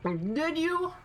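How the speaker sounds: high, whiny fake sob; mock crying. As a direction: whimper it, pretend hurt. Mock wounded reply to teasing.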